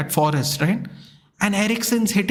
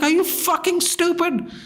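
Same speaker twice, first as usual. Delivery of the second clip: much higher, furious retold outburst; losing it; 10 semitones higher than usual. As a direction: explode at the player. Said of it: "I absolutely lose it".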